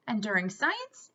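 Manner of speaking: usual speaking voice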